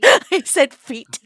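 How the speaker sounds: words squeezed out through laughter; laughing. Real, not acted. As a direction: laugh while repeating it. Laughing at friend's word.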